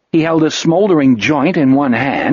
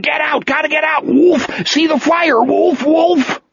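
high, frantic cries of terror; panicked screaming; 14 semitones higher than usual. A narrator's reading. Wolf panicking in the cinema, frantic high screaming. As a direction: scream to get out, seeing fire. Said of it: "he screamed".

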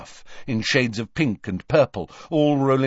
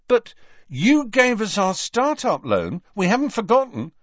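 high, insistent reminder; stern; 12 semitones higher, 4 dB louder than usual. A narrator's reading. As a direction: insist firmly, allowing no argument. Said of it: "said George sternly".

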